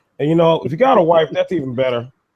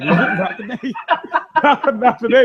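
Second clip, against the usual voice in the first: laughing; 10 semitones higher than usual. Retold or imitated. Mock refusal, laughing.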